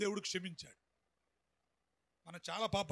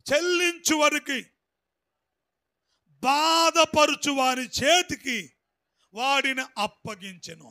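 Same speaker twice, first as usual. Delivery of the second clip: loud, slow, emphatic reading of the verse; forceful scripture reading; 4 semitones higher, 12 dB louder, slower than usual. Real, not acted. Preacher reading scripture aloud to the congregation, loud and slow. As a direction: declaim the verse to the church.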